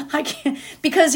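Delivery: laughing through the words. Real, not acted. Speaker amused at her own train of thought, laughing. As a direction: giggle as you say it.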